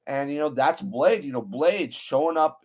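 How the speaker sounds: normal talking voice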